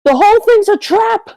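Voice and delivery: high, loud in-character outburst; alarmed shout. Acted. Player voicing Artemis's free-action reaction, alarmed shout. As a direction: shout it out as a sudden warning. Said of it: "Artemis is going to go".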